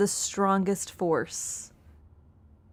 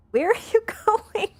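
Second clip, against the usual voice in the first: much higher and louder, laughing through it; laughing high squeal; 13 semitones higher, 7 dB louder than usual. Real, not acted. Streamer laughing at her cat pushing her, high squeal. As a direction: squeal it out while cracking up.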